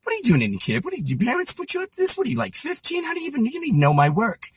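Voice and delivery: put-on impersonated comic grilling a young fan; Rickles. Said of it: "Rickles looks up and he goes".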